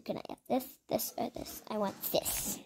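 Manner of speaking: soft unvoiced whisper; hushed wanting. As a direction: breathe it to yourself. Choosing items, whispered then exclaimed.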